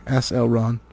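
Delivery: ordinary delivery, nothing marked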